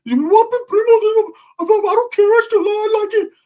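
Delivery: very high squeaky cartoon voice, loud and slow; Mickey Mouse. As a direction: put on a high, drawn-out falsetto. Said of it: "talk like Mickey Mouse".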